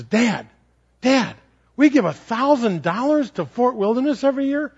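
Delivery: put-on a son asking his dad, as a small child; child's voice. A hint of an eager kid.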